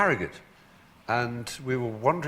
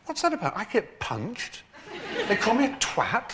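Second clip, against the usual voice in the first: high-pitched baffled complaint; Nicholas Lyndhurst; 12 semitones higher than usual. Retold or imitated. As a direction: mimic an aggrieved friend, voice pitched right up. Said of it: "Nicholas was saying".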